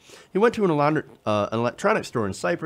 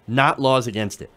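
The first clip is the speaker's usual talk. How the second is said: usual speaking voice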